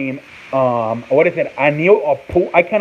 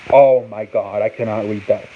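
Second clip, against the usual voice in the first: ordinary talk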